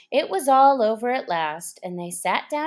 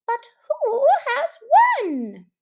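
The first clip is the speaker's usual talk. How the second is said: much higher, piping question; panting animals asking, much higher; high squeaky creature; 6 dB louder than usual. A narrator's reading. Party voiced, very high.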